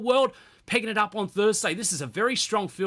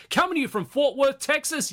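cowboy voice, sung up high on "Texas"; fake cowboy accent; 4 semitones higher than usual. Hyping the Texas golf stop.

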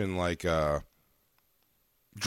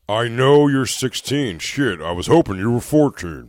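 louder, lightly put-on creep voice; mock predator voice; 5 dB louder than usual. Predator answering the decoy, as a mock creep. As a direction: play a shameless creep, projecting.

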